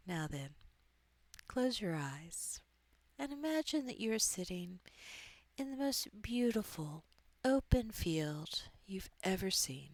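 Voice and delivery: soft, hushed meditation voice with long pauses; guided meditation. Acted. Coach guiding listeners into an open field, soft meditation voice. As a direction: ease the listener gently into a visualization.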